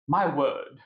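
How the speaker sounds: slow, lightly posh-sounding British accent; a quick British accent impression; upper-class British accent demo. Shown as a demonstration. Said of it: "like, a British accent".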